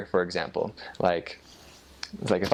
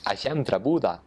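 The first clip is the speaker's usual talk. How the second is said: Northern Norwegian; 4 semitones higher than usual. Shown as a demonstration. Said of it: "it's a very nasally".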